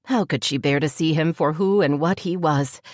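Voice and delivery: ordinary delivery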